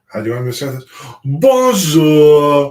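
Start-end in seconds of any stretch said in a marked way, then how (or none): 1.2-2.7 loud, hammy fake French greeting; bad French accent. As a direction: ham up a French host. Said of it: "That is the worst French accent I've ever heard".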